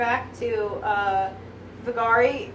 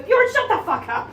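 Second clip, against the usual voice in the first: higher, louder mock telling-off; mock exasperation; 8 semitones higher, 4 dB louder than usual. Retelling Snorri silencing Bjorn, mock-exasperated. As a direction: imitate someone fed up, playfully.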